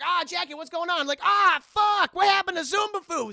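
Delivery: high, very loud frantic questions; mock alarmed yelling. Chris Tucker finding Jackie with a gun, alarmed yelling. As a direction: shout it in hammed-up alarm. Acted panicked partner arriving.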